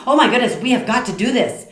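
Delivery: high, loud, urgent enthusiasm; excited, intense. Retold or imitated. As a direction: burst out with passionate insistence. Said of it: "I'm just excited or I'm intense".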